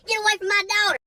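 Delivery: mother yelling at the creep to back off; outraged mom. Retold or imitated. Mom screaming at the stranger to get away, outraged high yell. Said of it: "she's like".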